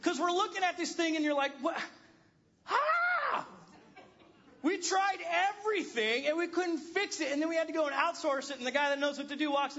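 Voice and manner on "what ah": high, sharp cry of frustration; a yelp of pure exasperation; exasperated cry. Retold or imitated. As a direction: throw up your hands with a yelp. Frustrated over unfixable sink.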